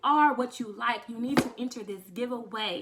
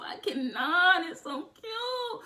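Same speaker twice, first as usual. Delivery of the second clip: high, slow gush over the wig; overcome with cuteness; 6 semitones higher, slower than usual. Real, not acted. Vlogger gushing to viewers over a wig, high and slow. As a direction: swoon over how cute it is. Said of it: "I'm about to cry".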